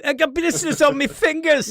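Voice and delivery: high, loud Scouse-style yell. Pretend. Beatles lyric quote.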